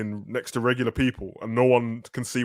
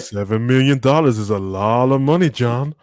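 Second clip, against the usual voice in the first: Fishburne impression; 5 dB louder, slower than usual. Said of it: "it's like".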